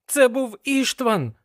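high-pitched, alarmed exclamation; agitated cry on waking; exclaiming. A narrator's reading. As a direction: blurt it out, pitch way up. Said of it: "вигукнув я".

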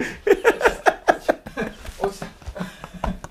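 long breathy, wheezing giggle; helpless airy laughter that trails on. Real, not acted. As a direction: keep laughing softly, almost without voice. Laughs on after the word.

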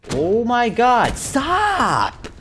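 long, loud, high-pitched plea; exasperated yell. Real, not acted. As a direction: drag it out in a frustrated shout. Fed up while being killed.

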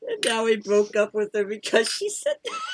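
high voice cracking up with laughter; helpless laughter. Real, not acted. Friend gloating over Kim's rejection, laughing. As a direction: barely get it out through laughing.

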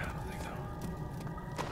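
unvoiced whisper; soft whisper. Shown as a demonstration. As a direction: whisper it under breath. Whispered vs plain repeat.

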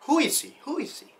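ordinary talk

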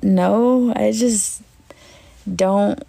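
ordinary talk, nothing marked

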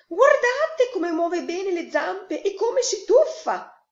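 high, loud, stretched-out delight; excited mother duck. A narrator's reading. Mother duck shows her friends the swimmer, excited. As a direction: show him off at the top of your voice.

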